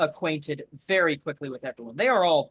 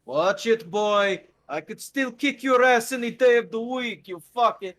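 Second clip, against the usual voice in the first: louder, higher mock threat; playful threat; 6 semitones higher, 4 dB louder than usual. Gogol teasing the Mac with a playful threat. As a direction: growl a teasing challenge at him. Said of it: "And he goes".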